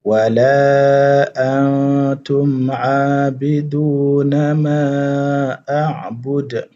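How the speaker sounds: slow, drawn-out chanted recitation; Quranic recitation. Shown as a demonstration. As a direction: recite it slowly, stretching the vowels. Recites surah again after encore.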